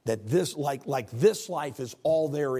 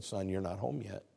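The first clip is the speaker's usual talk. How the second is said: soft, lower, tender reply; tender voice of God; 3 semitones lower, 8 dB quieter than usual. Acted. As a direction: gently comfort a grieving son. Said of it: "so tenderly said".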